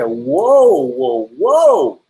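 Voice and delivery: drawn-out, astonished "whoa" in retelling; mock amazement. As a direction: act out a wife stopped short. Acting wife's amazed reaction.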